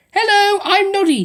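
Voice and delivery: high, loud eager greeting; cried out. A narrator's reading. Noddy greeting Tubby Bear, crying out eagerly. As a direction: call out your name brightly. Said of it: "he cried".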